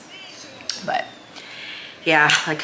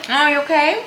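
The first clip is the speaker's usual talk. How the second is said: calling out; 12 semitones higher, 6 dB louder, faster than usual. Real, not acted. Checking on toddler nearby.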